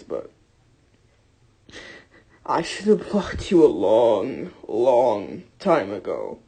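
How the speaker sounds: low, slow, close to crying; fighting back tears, voice sinking; holding back tears. Real, not acted. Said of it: "I'm trying not to cry too much".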